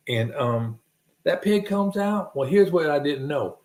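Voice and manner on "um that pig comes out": normal talking voice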